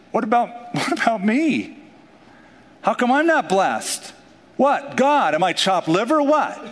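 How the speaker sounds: high, indignant mock complaint; hammed-up outrage at someone else's blessing; mock jealous whine. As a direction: whine like a slighted churchgoer.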